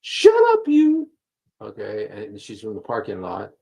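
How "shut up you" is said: very high, loud mock shriek; high-pitched yelling in imitation; imitated screaming. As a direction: imitate someone screaming at a stranger, shrill and loud. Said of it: "she was screaming at me".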